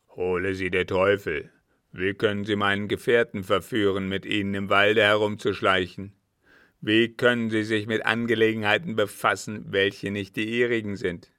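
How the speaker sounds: loud, indignant tirade; angry scolding. A narrator's reading. Captain scolds the girl for meddling, angry. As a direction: berate her loudly and forcefully. Captain berates Adeline in story.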